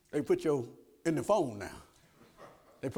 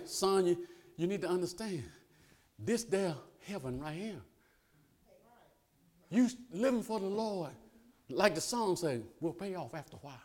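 softer, hushed fatherly advice; gentle reassurance; 3 semitones lower, 11 dB quieter than usual. Retold or imitated. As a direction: ease the boy's hurt quietly. Grandfather comforting his grandson.

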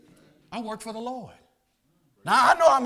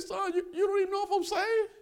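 high, softened character voice; acted father's reply; 13 semitones higher, 4 dB quieter than usual. Father answering his saved son, high soft acted voice.

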